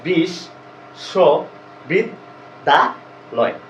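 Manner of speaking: বিশ্ববিদ্যালয় said as বিশ, স, বিদ, দা, লয় with pauses; syllable by syllable. Shown as a demonstration. Teacher breaks বিশ্ববিদ্যালয় into syllables for students. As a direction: count out বিশ্ববিদ্যালয় one syllable at a time. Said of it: "বিশ্ববিদ্যালয় শব্দটা যদি উচ্চারণ করি".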